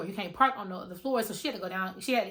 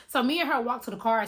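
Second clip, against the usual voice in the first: plain reading voice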